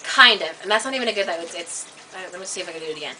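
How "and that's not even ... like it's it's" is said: usual speaking voice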